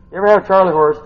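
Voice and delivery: ordinary talk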